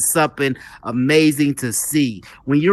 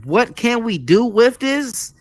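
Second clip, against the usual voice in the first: high, slow imitated puzzlement; puzzled wondering; 7 semitones higher, slower than usual. Host recalling his first thoughts on the newcomer, puzzled wondering. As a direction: stretch the question out high, as if retelling bafflement. Voicing reaction to the man.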